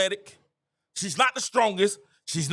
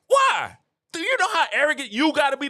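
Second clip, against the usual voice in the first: indignant; 4 semitones higher than usual. Real, not acted. Heated rant about humility.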